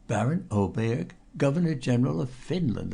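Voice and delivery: ordinary delivery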